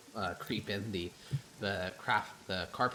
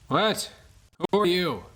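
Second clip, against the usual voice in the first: put-on loud, high drunken challenge; drunk shout; 12 semitones higher, 14 dB louder, slower than usual. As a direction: bark it at the stranger. Drunk Harris confronts stranger.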